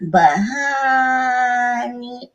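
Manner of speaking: drawn out, very slow; slow motion. Real, not acted. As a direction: stretch every word slowly. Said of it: "Talking in slow motion".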